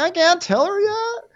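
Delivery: very high, drawn-out wail; hammed-up weepy protest; mock tearful whine. Crying girl who hasn't told Paige yet, mock wail.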